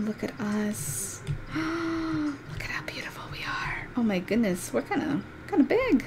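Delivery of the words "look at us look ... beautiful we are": hushed, breathless wonder; softly marvelling at the screen; awed whisper. Real, not acted. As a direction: gasp then whisper in awe. Awed by her cat character.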